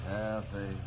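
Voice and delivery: calling faintly. Acted. Dying man calling lost love.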